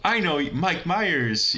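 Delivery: high, slow, gushing hello; starstruck fan greeting. Pretend. Pretending to meet Mike Myers, gushing high and excited. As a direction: gush like a fan meeting his idol. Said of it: "like, basically say".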